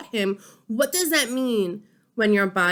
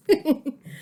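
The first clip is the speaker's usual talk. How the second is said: short laugh; 5 semitones higher than usual. Real, not acted. Laughs at own joke.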